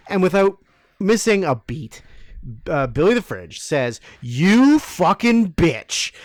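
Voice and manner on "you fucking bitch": slow, drawn-out joking insult; hammed-up rude jab at the waitress; sarcastic fake insult, slowed right down; mock insult. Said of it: "Like, jokingly".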